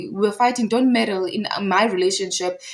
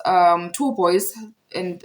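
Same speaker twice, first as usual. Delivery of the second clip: ordinary talk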